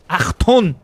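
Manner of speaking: high, loud barked mock German Achtung; mock German shout. Teasing a friend about German names, mock German shout. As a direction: bark Achtung like a movie German.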